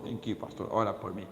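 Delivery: lightly voiced frail father asking for prayer; old dad faking pain, pleading; feeble old father.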